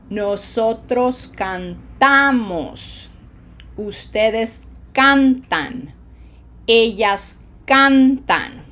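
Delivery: slow drill, TA punched in cantamos, CAN in cantan; exaggerated syllable stress. Shown as a demonstration. Teacher leading class repetition, slow with stressed syllables pushed hard. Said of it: "lungs work a little bit harder".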